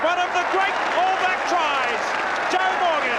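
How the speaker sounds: building from eager to thrilled try call; excited call. Real, not acted. Commentator calls a great try.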